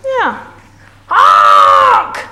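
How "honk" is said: long, loud blaring horn shout; yelled honk. A narrator's reading. Dump truck barging down the road, yelled honk. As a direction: blare it out like a big truck horn. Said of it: "yelled the big dump truck".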